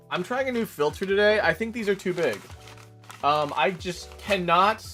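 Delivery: ordinary delivery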